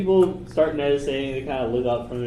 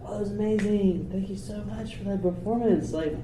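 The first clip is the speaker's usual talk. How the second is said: gushing innkeeper; 8 semitones higher than usual. Acted. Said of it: "she's just like".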